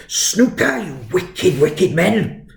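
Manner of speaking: high-pitched, scandalised mock-Welsh headmaster; hammed-up Welsh outrage, pitch leaping; attempted Welsh accent. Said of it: "can't do a Welsh accent".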